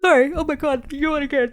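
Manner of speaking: talking through a big yawn; words stretched by a yawn. Real, not acted. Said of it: "you yawn".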